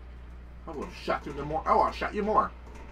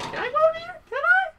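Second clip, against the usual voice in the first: very high squeaky pleading; high squeaky can-I voice. Retold or imitated. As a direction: squeak it like a tiny excited kid. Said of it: "I'm like".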